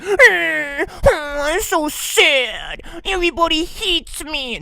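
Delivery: high, very slow, loud mock whimper; whiny exaggerated poor-me voice; mopey self-pity. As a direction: ham up a self-pitying mope. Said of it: "moping around like a motherfucker".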